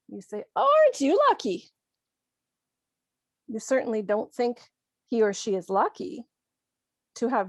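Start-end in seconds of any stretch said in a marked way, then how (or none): 0.5-1.7 much higher, fast mock congratulation; sarcastic. Shown as a demonstration. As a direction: tease with exaggerated, high-pitched envy. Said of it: "that's sarcasm".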